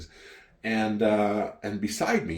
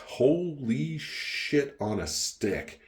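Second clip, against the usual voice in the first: very slow, higher-pitched awe; awestruck; 6 semitones higher, much slower than usual. Retold or imitated. Retelling the first glass of wine, awestruck. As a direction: stretch out every word in amazement.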